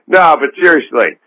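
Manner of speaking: an interviewer who won't accept the answer. Retold or imitated. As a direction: press them for a real answer. Retold back-and-forth about Loveline.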